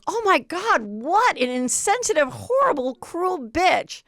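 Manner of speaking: high, hammed-up shocked cast mates; mock scandalized outrage. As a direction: parody the outraged chorus. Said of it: "they're all like".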